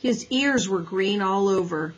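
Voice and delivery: ordinary delivery, nothing marked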